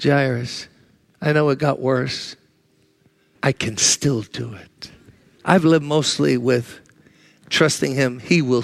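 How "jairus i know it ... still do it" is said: lower, softer, slow reassurance; tender Jesus voice. Acted. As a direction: quietly promise a grieving father. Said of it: "Jesus said to Jairus".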